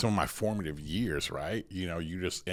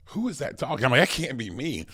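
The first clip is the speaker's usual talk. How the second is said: higher-pitched, incredulous reaction; retold disbelief; 7 semitones higher, 7 dB louder than usual. Hearing himself on the tape recorder, incredulous. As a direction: act out not recognizing your own voice.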